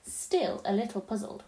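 usual speaking voice

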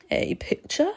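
high, rising, wary question; suspicious. A narrator's reading. Vampire hearing the boy has seen his picture, suspicious. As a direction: question it warily, voice lifting. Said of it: "asked suspiciously".

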